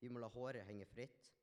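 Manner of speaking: ordinary delivery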